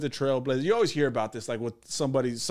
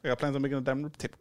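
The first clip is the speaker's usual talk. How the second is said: fast, softer run through "plans on making a dining room table"; rapid repeat; 5 dB quieter, much faster than usual. Pretend. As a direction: rattle "dining room table" off quickly, softly. Repeats the line after voice remark.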